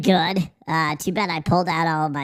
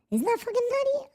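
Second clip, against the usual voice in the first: fast, way higher squeak on nutty; squeaky high repeat; faster than usual. Shown as a demonstration. Marvelling at the stock market to chat, in a high fast squeak.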